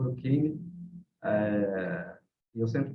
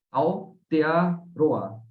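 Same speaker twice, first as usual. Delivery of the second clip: "Aotearoa" drawn out very slowly, in separate parts; slow syllable-by-syllable; 3 dB louder, much slower than usual. Shown as a demonstration. Teacher showing listeners the Māori country name, slow syllable-by-syllable.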